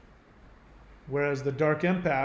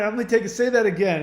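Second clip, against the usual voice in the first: ordinary talk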